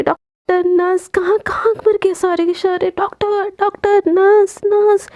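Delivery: frantic friend yelling for hospital staff; shouting for help. Acted. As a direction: yell down the hospital corridor, stretching the words slowly. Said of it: "ऐसे चिल्लाने लगती है".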